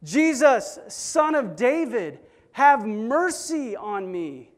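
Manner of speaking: high, loud, slow desperate cry. Retold or imitated. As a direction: stretch the plea and hold it loud. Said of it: "But he yells out even louder".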